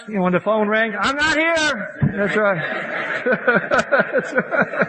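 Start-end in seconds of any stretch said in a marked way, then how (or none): 1.0-2.1 high, slow, sly fib; drawn out like a wink to the bartender; a drinker at the bar faking he is out, high and slow; mock bar excuse. Mimicking bar phone excuse. 2.1-4.9 high laughing agreement; chuckling along with the room. Real, not acted. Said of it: "laughing with audience".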